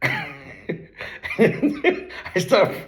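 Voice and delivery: high, laughing false start; real laughter swallowing the words; cracking up. Real, not acted. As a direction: begin the confession and collapse into laughter. Cracks up before confessing.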